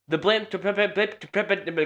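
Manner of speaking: words catching on a coming sneeze; about to sneeze. Real, not acted. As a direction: sneeze building up, stuttering. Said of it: "Oh, sorry, I'm gonna sneeze".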